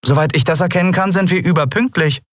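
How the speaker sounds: deutlich höher und schnell; Kapitänin, trotzig. A narrator's reading. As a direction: schnell und spitz dagegenhalten. Andriel trotzig. (Andriel weist den Vorwurf zurück, hoch und schnell)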